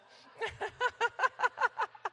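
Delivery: loud, high burst of laughter; loud laugh at the memory; big laugh. Real, not acted. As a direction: let a real laugh break out loud. Laughing at own story.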